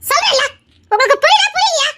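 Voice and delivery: shouting. Acted. Wife shouting at pleading husband, frustrated and loud. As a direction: yell at him that he doesn't understand. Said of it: "கத்தாத".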